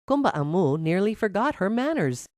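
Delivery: usual speaking voice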